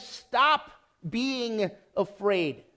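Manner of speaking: slow, weighty command to stop being afraid; stop it, literally. Retold or imitated. Preacher gives the literal Greek, slow and firm. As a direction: lean on each word of "Stop being afraid" slowly. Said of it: "Literally, it says".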